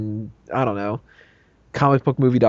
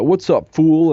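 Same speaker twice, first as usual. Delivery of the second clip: raised, punched-up jive greeting; hammed-up jive-talking robot, louder and higher; 5 semitones higher, 6 dB louder, slower than usual. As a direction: call it out in mock jive. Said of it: "a jive talking".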